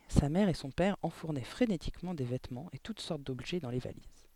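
usual speaking voice

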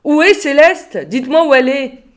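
loud, high pleading demand; desperate demand. Acted. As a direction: demand an answer now. Desperate caller demanding answers.

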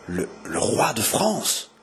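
halting, slow stunned murmur; startled murmur. A narrator's reading. Jeanne recognising the king of France, startled murmur. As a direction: stammer it slowly, stunned. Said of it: "murmura Jeanne en tressaillant profondément".

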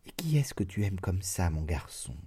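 soft whispered tender question; moved mother asking the servant gently, tender hush. A narrator's reading. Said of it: "maman fut attendrie".

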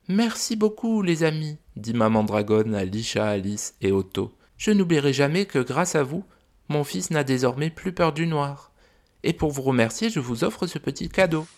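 high, gentle mother dragon voice; a fond, worried dragon mum. Acted. Mother dragon telling the visitors about her son, high gentle voice.

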